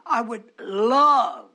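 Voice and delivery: "love" stretched long and loud; drawn-out LOVE. Real, not acted. Preacher longing to speak to his people, "love" drawn out. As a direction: lean hard and slowly on "love".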